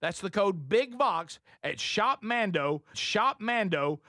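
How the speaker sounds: upbeat sponsor read. Acted. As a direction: sell it like a radio ad. Reads sponsor ad.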